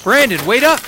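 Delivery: loud call after someone. Acted. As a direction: shout across the camp. Calling after running friend.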